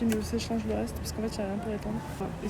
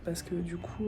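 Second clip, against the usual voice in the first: whisper; 4 semitones lower, 8 dB quieter, slower than usual. Real, not acted. Confiding aside.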